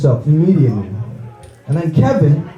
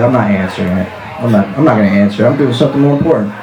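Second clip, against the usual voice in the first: lower, fast brush-off; a lower, hurried brush-off during sex; low dismissive voice; 8 semitones lower, faster than usual. Pretend. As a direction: wave off the knocking quickly in a low voice. Refusing to open the door.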